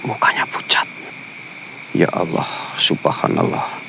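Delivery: real frightened whisper; scared whisper. Real, not acted. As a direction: whisper it so nothing hears you. Frightened ghost hunt at night.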